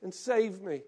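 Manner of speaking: loud, pleading cry, then easing off; crying out to the Lord. Retold or imitated. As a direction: call to the Lord with everything you have. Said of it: "you cry out to the Lord".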